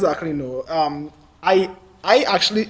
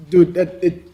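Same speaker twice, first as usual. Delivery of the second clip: ordinary talk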